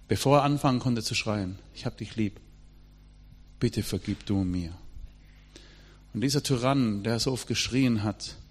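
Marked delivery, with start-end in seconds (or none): 1.7-4.7 quiet plea. Retold or imitated. Son pleading before his shouting father.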